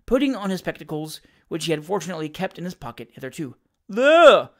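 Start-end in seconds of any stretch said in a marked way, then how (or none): 3.9-4.5 Tupman pointing out. A narrator's reading. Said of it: "said Mister Tupman".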